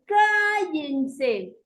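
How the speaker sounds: long, slow, high yell for silence. Shown as a demonstration. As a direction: stretch it out loudly over the class. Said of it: "Entonces ustedes están elevando la voz".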